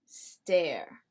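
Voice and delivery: "Stare" starting straight on a long S; one syllable "Stare", no vowel before the S; clean initial S. Shown as a demonstration. Teacher repeats the right word, clean initial S. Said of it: "not Esther".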